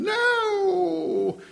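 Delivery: put-on one defiant toddler shriek; child still screeching. Boy still refusing while father pacifies, shrill. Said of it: "a little bit further between".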